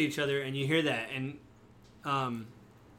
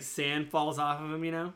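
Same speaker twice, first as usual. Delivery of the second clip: ordinary talk, nothing marked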